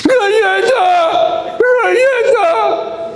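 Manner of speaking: shrieked, drawn-out imitation tantrum; hammed-up bawling little girl; crying toddler. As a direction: wail like a small child, extremely high. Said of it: "¿Vas a llorar".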